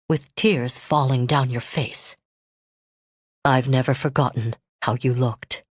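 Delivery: strained remorse. Acted. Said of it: "anger was gone... replaced by strain".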